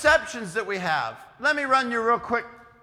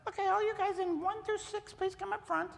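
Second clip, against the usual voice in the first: much higher, soft Sunday school teacher calling; sweet teacher voice; 12 semitones higher, 9 dB quieter than usual. Retold or imitated. Teacher calling the little children to the front, sweetly.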